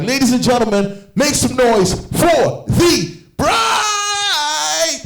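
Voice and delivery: high, booming wedding introduction with a long held last word; drawn-out, soaring emcee shout; hype MC announcement. Retold or imitated. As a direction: announce the bride like a ring announcer. MC introduces bride to audience.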